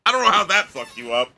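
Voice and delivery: ordinary delivery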